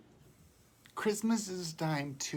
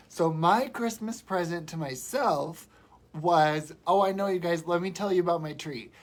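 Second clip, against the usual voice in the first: ordinary talk, nothing marked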